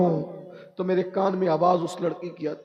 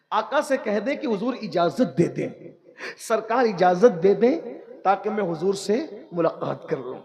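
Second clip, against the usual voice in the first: ordinary delivery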